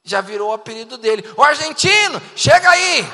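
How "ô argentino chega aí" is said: loud, high teasing shout; calling out. Acted. Teenagers hailing a friend by his new nickname, loud calling out. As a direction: holler the nickname across to him. Teens call out the mocking nickname.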